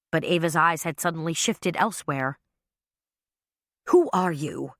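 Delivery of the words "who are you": demanding. A narrator's reading. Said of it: "she demanded".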